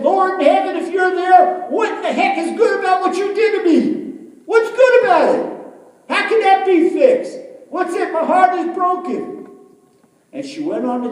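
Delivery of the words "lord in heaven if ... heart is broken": full-voiced, very high shouted demand; angry yelling. Heartbroken woman yelling up at God, angry. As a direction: yell up at heaven in outrage. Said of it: "she yelled up".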